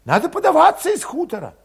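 put-on high, tense young Cossack voice; high-voiced young troublemaker; Valet's voice. A narrator's reading. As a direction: pitch him up high and edgy.

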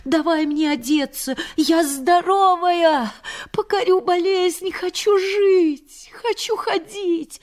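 feverish, pleading determination; defiant excitement. Retold or imitated. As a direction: cry out that she will live. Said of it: "Вскочила раз".

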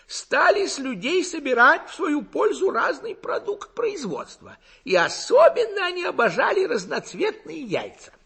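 high, loud, gurgling lecturer; rapturous throaty burble. A narrator's reading. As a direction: burble on high and loud, carried away by your own speech. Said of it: "Горловой голос Гаврюшки булькал упоённо".

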